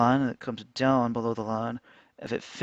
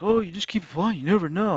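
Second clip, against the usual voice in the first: higher, lilting mimicry; mocking encouragement; 6 semitones higher, faster than usual. People urging him to keep applying, mockingly lilting. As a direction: parrot their pep talk in a raised pitch.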